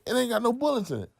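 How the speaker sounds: high-pitched, quick, amused; high fast quip. Retold or imitated. Otis quoted, punchline.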